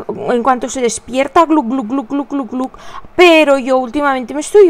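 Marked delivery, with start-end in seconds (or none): 1.5-2.7 soft imitated gulp gulp sounds; gulping noises. Mother imitating her son gulping water, soft gulping sounds. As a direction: mimic the boy glugging water. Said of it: "me quejo del niño".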